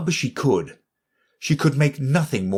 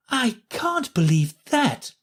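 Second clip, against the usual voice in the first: put-on high, slow disbelieving girl voice; Alice refusing to believe; doubtful Alice; 13 semitones higher, slower than usual. A narrator's reading. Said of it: "said Alice".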